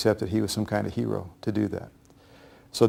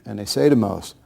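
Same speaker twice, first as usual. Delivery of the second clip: normal talking voice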